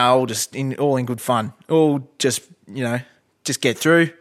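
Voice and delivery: normal talking voice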